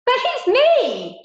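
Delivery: high, loud, slow anguished cry; shouted again. Acted. Girl begs her family to see her, shouting. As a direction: scream to be heard. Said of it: "she shouted again".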